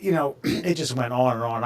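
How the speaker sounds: ordinary talk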